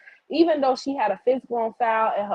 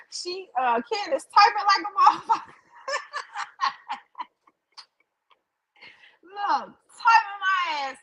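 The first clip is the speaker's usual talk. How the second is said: laughing; 12 semitones higher than usual. Real, not acted. Laughing at chat about her typing.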